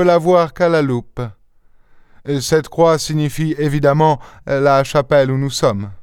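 high, lightly voiced detective; un détective légèrement joué, voix haute; Sherlock Holmes. Holmes explaining, raised voice.

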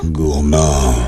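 low whimpering drag voice; low mock-tearful growl; crazy deep voice. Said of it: "Cette voix de fou".

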